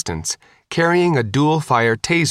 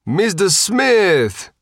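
put-on loud, high Sicilian accent greeting; 9 semitones higher, 3 dB louder, much slower than usual. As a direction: call out warmly in a Sicilian accent. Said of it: "with a thick Sicilian accent".

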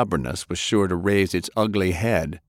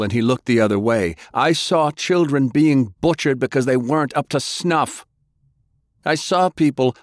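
furious accusation; 6 semitones higher than usual. Acted. Grandson raging at his grandfather about his father, furious. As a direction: hurl every word at him.

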